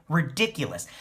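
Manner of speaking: usual speaking voice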